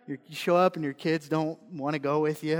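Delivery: ordinary talk, nothing marked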